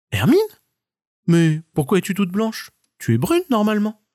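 a hint of a surprised little marmot; marmot character.

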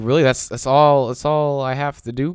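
loud disbelieving outburst; incredulous. Retold or imitated. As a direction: shout it in mock disbelief.

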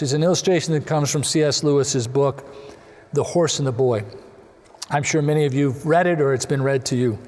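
ordinary delivery

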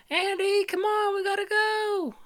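very high, urgent mom voice; Andy's mom. Acted. Andy's mom rushing Andy out during a fire, very high. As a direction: hurry your kid out the door. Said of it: "So Andy's mom is like".

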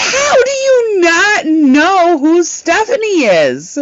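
very high, slow and drawn out; exasperated disbelief. Retold or imitated. Streamer scolding her friend, very high and slow. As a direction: stretch it out in mock outrage.

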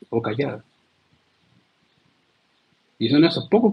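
normal talking voice

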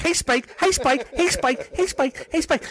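high, eager sidekick voice; little cartoon dog. Boss plays the Looney Tunes sidekick dog, high and eager. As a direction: pester the big dog like a tiny yappy pal. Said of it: "the little dog follow him".

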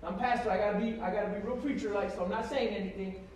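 ordinary delivery

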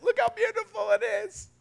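high-pitched mock gloating; gleeful rubbing it in. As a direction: gush in a very high, teasing voice. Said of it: "I was rubbing it in".

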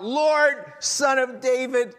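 high, loud, slowed plea on "Lord"; desperate mother crying out to Jesus for her daughter, pleading loudly; retold cry for mercy. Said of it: "She just cries out".

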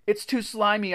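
high-pitched complaining moan. A narrator's reading. Narrator's hands slip on creature, moaning. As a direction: moan in helpless frustration. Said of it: "I moaned".

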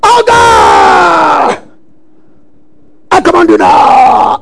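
loud commanding yell at a demon; hammed-up exorcist shout; mock deliverance shout. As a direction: bark the order out like a shouting deliverance minister. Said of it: "I did it before too".

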